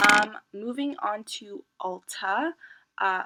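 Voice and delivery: normal talking voice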